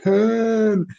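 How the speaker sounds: high mock cry; Khan yell. Caught by girlfriend at the mirror, high mock yell. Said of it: "sees me in the mirror going".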